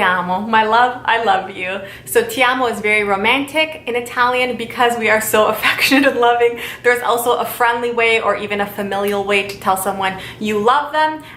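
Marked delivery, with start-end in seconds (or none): none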